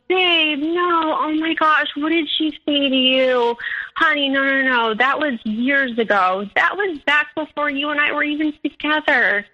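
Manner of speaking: put-on high, placating boyfriend voice; pleading denial. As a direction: imitate a cheater talking his way out. Boyfriend denies cheating, pleading.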